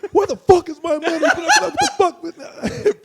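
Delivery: very high, loud screechy imitation; hammed-up high yell with laughter; mock furious shouting. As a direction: act out a man making a scene, laughing through it. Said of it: "He's gonna start a scene".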